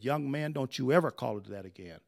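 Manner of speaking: low, quiet admonishing teacher; correcting teacher. Retold or imitated. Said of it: "My teacher in high school corrected me".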